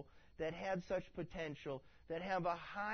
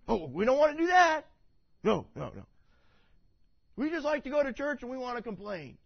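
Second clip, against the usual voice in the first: whiny refusal; 7 semitones higher, 11 dB louder than usual. Retold or imitated. Israel's whiny reply mocked.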